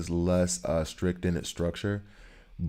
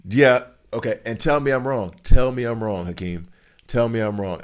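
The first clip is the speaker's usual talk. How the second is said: loud, higher challenge; loud playful dare to a viewer; challenging; 4 semitones higher, 9 dB louder than usual. Real, not acted. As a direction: dare him to disagree, loudly.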